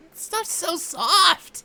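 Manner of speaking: high, slow dreamy gush. Acted. Dragon gushes about wool.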